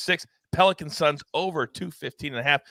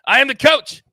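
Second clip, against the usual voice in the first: excited; 10 semitones higher, 7 dB louder than usual. Real, not acted. Sign-off self-hype.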